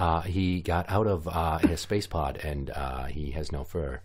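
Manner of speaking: low, soft, halting impression full of uhs; Jeff Goldblum. Head monkey remarks on hairless spaceman, halting Goldblum impression. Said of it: "Jeff Goldblum is one of the head monkeys, the way that I sound".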